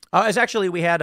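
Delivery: ordinary delivery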